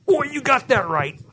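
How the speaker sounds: mock grumbling; griping and complaining. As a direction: play someone muttering gripes while digging away. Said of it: "through griping and complaining".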